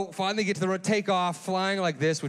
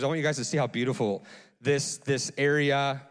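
usual speaking voice

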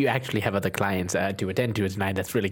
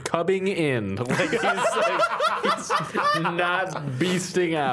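high giggly explanation; giggling, slow at the end; laughing; 8 semitones higher, slower than usual. Real, not acted. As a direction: talk through the giggles.

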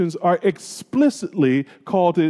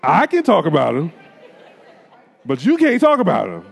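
raised, emphatic stop-right-there protest; protective "hold up". Retold or imitated. To a friend trashing his brother, a firm protective hold up. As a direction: cut off a friend who's badmouthing your brother. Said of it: "you're like".

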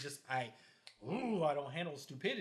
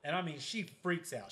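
ordinary delivery

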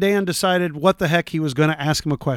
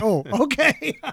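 higher, laughing "Oh, okay"; 7 semitones higher, slower than usual. Retold or imitated. Amused at the mix-up, laughing it off. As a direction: giggle through the reply. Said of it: "I was like".

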